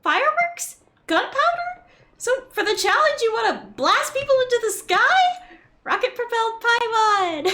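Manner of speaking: high, loud excited sidekick voice; squeaky companion, thrilled and alarmed; Paimon voice. Acted. Voices Paimon reading game lines.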